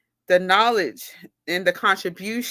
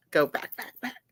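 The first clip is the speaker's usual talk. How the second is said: softer, lower mutter, rewinding her thoughts; muttered to herself; 5 semitones lower, 5 dB quieter than usual. Real, not acted. Correcting herself mid-talk, quiet mutter. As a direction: murmur it under your breath. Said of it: "let me go back".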